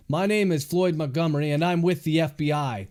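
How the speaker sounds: ordinary delivery, nothing marked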